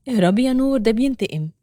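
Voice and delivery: usual speaking voice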